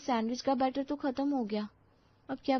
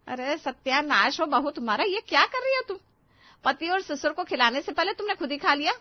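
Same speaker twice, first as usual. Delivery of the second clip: higher, louder outraged scolding; angry mother-in-law; 6 semitones higher, 5 dB louder than usual. Acted. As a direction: catch the bride eating first and blow up. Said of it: "पीछे से आवाज आती है".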